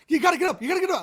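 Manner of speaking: high, loud imitation of an excited mom; urgent waking call. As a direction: act out rousing a sleeping child urgently. Mom waking child, acted in story.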